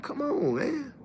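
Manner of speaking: soft, weary "come on, man"; exasperated aside. Retold or imitated. Answering critics, softly exasperated. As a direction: let it drop out quietly, fed up. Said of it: "I'm like".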